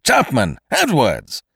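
loud, high, drawn-out summons; crying out. A narrator's reading. Kesselbach summoning secretary and servant, crying out. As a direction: shout for both your men. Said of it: "Mister Kesselbach cried again".

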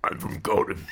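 low, unvoiced-sounding growled whisper; Batman growl. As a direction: growl it like a brooding superhero. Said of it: "say Gotham in the Batman growl".

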